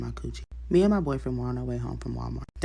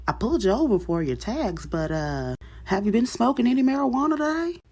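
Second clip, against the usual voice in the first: raised, loud officer voice climbing high; questioning cop; 10 semitones higher, 5 dB louder than usual. Acted. Cop at car window asking about marijuana, questioning. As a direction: push the question up high at the end.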